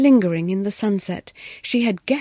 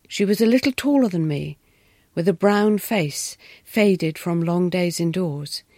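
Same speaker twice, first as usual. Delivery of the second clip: ordinary delivery, nothing marked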